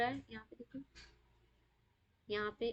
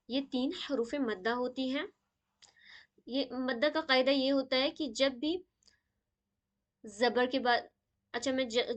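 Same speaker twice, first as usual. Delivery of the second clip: ordinary talk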